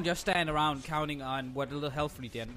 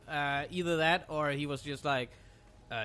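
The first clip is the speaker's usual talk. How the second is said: ordinary delivery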